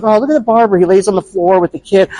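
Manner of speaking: high, mock-gushing voice; mock the viral feel-good post; gushing Facebook fan.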